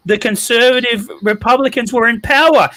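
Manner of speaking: high-pitched, incredulous protest; heated objection. Real, not acted. Defending a fired actress to the panel, heated objection.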